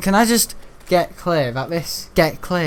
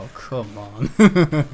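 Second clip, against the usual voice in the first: exasperated dad; much slower than usual. Retold or imitated. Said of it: "My dad just thought".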